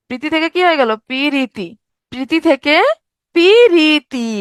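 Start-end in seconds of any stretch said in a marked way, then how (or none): 1.1-1.7 পিরিতি in three even syllables; inserted i sound. Shown as a demonstration. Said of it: "ই ধ্বনি যুক্ত হইছে". 3.3-4.3 পিরিতি loud, high, syllable by syllable with long vowels; inserted i sound. Shown as a demonstration. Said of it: "ই ধ্বনি যুক্ত হইছে".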